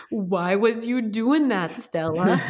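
put-on high, loud, slow crying child voice; mock-wail it like a toddler in timeout; hammed-up sobbing toddler scolding herself; imitating crying toddler. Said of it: "she's like".

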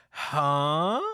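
loud, long rising "huh"; hammed-up confused yelp sliding upward; baffled huh. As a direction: act out utter bewilderment. Retelling Denji's baffled reaction.

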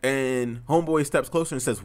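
usual speaking voice

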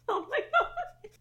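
loud words through real laughter; laughing hard. Real, not acted. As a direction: gasp it out while cracking up. Cracking up at the joke.

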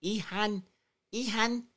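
sounding out "Ilhan" high; practicing Ilhan. Shown as a demonstration. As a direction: rehearse "Ilhan" on rising pitch. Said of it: "I need to say that right".

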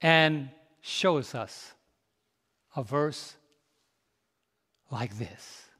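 ordinary talk, nothing marked

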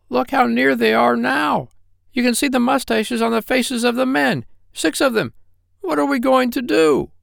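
very high squealing pig; panicking Gub-Gub. Acted. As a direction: wail in alarm, pitched right up.

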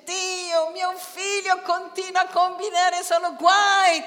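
high, pleading mother's prayer; anxious mother crying to God; worried mother praying. Pretend. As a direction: plead for your son. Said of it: "Tu sei là che dice".

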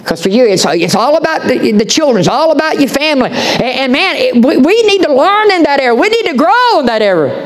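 high, driving preacher's cadence; impassioned preaching. Acted. Pastor urging the congregation, impassioned preaching. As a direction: preach it up with rising urgency.

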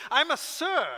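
high, loud, hammed-up posh British voice; pompous British. A pretend-offended [name] putting on British airs, high and loud. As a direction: puff up like an offended English lord. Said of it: "he could have gotten all British".

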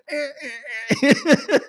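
high squeaky "eh" noises in jerks; short, high mechanical squeals; imitated creaky car window. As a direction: mimic a stiff window being cranked down.

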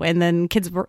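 normal talking voice

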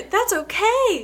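high, drawn-out "okay"; mock upbeat. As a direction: stretch "okay" slowly up high in mock cheer. Exaggerates the upward voice being teased.

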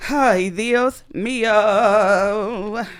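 slow stretched Spanish sigh; drawn-out exclamation. Real, not acted. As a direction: drag out the Ay Dios mío.

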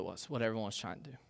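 dropped to a quick whisper; whispered aside. Real, not acted. Pastor whispers a quick self-deprecating aside to the congregation. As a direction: mutter it under your breath, fast.

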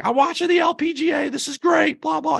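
high-pitched mock excited fan voice. Fans texting the host about Georgia Hall's putt, imitated excitement. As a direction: squeal it like fans going nuts over the golf. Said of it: "Everyone's so excited".

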